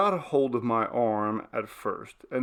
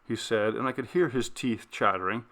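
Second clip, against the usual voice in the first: usual speaking voice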